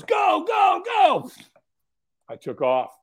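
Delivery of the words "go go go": high, driving yelled chant; trainer's urging shout. Retold or imitated. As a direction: holler them off the starting line. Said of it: "And he goes".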